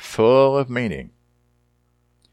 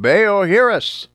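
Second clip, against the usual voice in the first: desperate cry; 9 semitones higher, 5 dB louder, slower than usual. Retold or imitated. Prophets calling on Baal.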